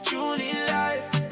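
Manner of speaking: ordinary talk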